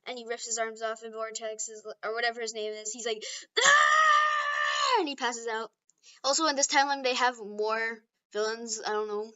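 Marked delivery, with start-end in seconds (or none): 3.6-5.0 very high, loud, sustained shriek; agonized scream. Acted. Man losing his arms, agonized scream. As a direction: scream it long before passing out.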